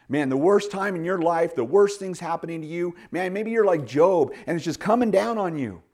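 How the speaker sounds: high, loud pulpit appeal; impassioned preacher climax; preaching at full force. Acted. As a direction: drive it home to the congregation. Preacher building intensity.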